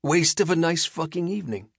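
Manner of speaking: high, breezy and cheerful; cheerful menace, like it's nothing; bright villain. A narrator's reading. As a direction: Skem cheerfully brushing off the fight. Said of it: "says Skem brightly".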